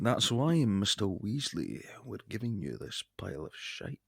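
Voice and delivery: impression sliding into a Scottish accent; suddenly Scottish. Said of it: "I don't know why I'm suddenly Scottish".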